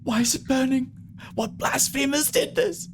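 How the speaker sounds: very high, breathy falsetto; high, breathy, fretful character. Acted. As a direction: play a distressed woman behind a door, pitched high. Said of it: "a breathy voice going".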